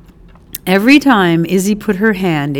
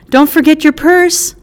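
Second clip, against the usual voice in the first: higher, louder call after her; 9 semitones higher, 5 dB louder than usual. Acted. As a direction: shout a helpful reminder. Calling after the lady.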